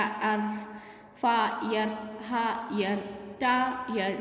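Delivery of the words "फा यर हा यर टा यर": फा, यर, हा, यर, टा, यर with a break before each यर; split into syllables. Shown as a demonstration. Teacher showing the shared sound, syllable by syllable. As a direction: break each word in two to isolate यर. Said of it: "आई, आर का sound आ रहा".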